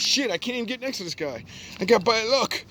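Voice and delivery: very high panicky whine; hammed-up frightened complaint, rising high; mock whining rival. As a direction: squeal like a spooked loser. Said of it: "you be like".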